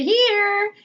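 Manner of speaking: high, loud, drawn-out call; playful holler from an unseen child. A narrator's reading. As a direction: sing it out long and high. Invisible child calls out to mother.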